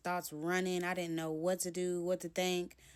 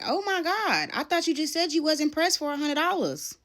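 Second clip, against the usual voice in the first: indignant disbelief; 10 semitones higher, 10 dB louder than usual. Retold or imitated. Retorting to him in disbelief.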